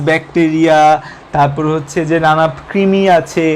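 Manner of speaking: ordinary talk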